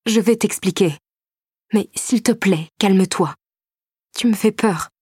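scared woman confessing her fear to him; frightened plea. A narrator's reading. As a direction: beg him to calm down. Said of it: "avouai-je".

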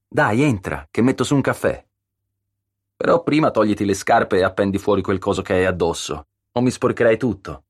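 a hint of friendly scolding; homely, bossy hostess. A narrator's reading. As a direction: usher a friend in, then give orders. Concetta welcomes and orders the wet visitor.

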